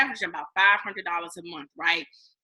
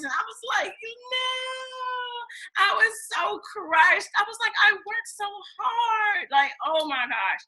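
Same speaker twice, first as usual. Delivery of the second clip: much higher, cracking, near tears; high tearful disbelief; crushed crying; 11 semitones higher than usual. Real, not acted. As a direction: let the voice crack in despair.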